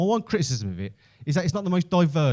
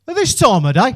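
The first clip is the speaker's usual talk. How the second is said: gruff dad; 8 semitones higher, 8 dB louder than usual. Retold or imitated. Dad catching son.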